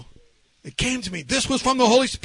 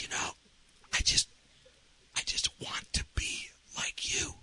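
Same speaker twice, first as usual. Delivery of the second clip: hushed whisper with long pauses; 13 dB quieter than usual. Retold or imitated. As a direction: whisper it haltingly, as a confession. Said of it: "I started whispering".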